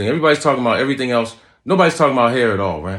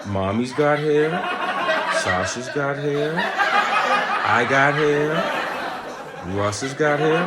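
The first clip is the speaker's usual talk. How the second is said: higher, slow sing-song taunt with laughter; teasing son; 6 semitones higher, much slower than usual. Retold or imitated. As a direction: list it out slowly like a taunting kid. Son teasing bald dad.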